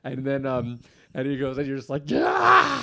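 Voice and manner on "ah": high, loud comic rage scream; mock-crazed yell of frustration; Sebastian Maniscalco going crazy. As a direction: scream like a driver losing it. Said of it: "You start to go crazy".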